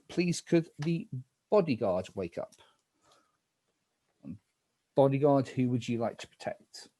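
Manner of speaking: low, hushed night-phase calls; spooky game narrator. Acted.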